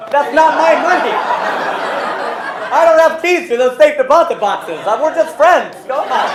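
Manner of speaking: high, very loud acted objection; shouted protest. Bank teller objecting to the boss, shouted protest. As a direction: protest at full volume, pitch way up.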